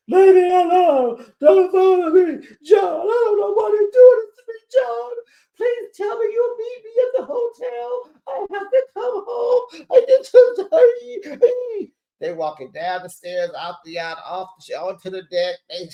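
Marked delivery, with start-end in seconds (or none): 0.1-11.9 very high, hammed-up wail; exaggerated loud, squealing sobbing plea; mock crying. Imitating Lisa crying to John and Heather, hysterical wailing. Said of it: "Now she crying".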